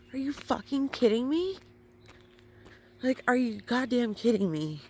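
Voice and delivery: real outrage at the ruined flowerbed; outraged. Real, not acted. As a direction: demand it of the absent digger.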